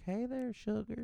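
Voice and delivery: put-on high, slow sugary old lady; old woman voice. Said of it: "an old woman would come up to you and she'd be like".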